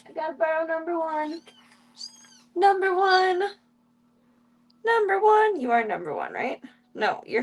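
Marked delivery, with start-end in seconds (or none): 0.1-1.4 higher, sing-song pet voice; pet talk. Real, not acted. Streamer asking the mom to lend number one, pet talk. 2.5-5.6 high, loud cooing call; calling a pet. Real, not acted. Streamer calling baby number one, high pet-calling voice.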